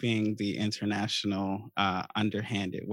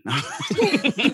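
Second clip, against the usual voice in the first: high burst of laughter; laughing; 13 semitones higher, 4 dB louder than usual. Real, not acted. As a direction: laugh out loud at the joke. Laughs at donation joke.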